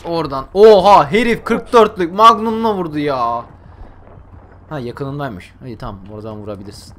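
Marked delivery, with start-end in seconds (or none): 0.5-3.5 loud opening cry, then drawn-out disbelief; stunned outburst. Real, not acted. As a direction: exclaim loudly at an unbelievable shot. Stunned by enemy's shot. (şaşkınlıkla, yüksek sesle)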